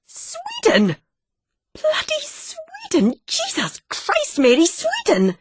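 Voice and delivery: very high, slow shocked cry; father shocked his daughter goes to Sweden; stunned outburst. Acted. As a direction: explode in disbelief at the distance.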